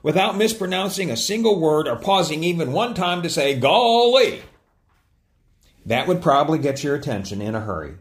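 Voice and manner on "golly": Gomer Pyle. Retold or imitated. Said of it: "Gomer Pyle".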